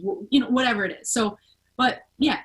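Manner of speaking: ordinary delivery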